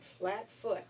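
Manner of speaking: usual speaking voice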